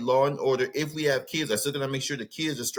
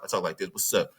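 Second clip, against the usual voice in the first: lower, faster West Coast Cali talk; relaxed Cali slang voice; his old Cali voice; 6 semitones lower than usual. Shown as a demonstration. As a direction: drop into the old California way of talking. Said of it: "I ain't talked like this before I moved to the South".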